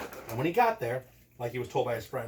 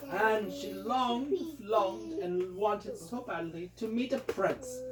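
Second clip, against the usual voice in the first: dreamy drawn-out longing; 5 semitones higher, much slower than usual. A narrator's reading. Dreamy fairy-tale narration.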